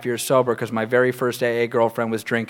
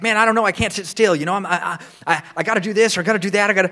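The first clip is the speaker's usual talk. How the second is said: higher, rushed, stammering excuses; agitated sponsee; 6 semitones higher, faster than usual. Retold or imitated. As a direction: restless guy tripping over his own words. Acting out restless sponsee's excuses.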